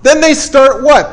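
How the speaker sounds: ordinary talk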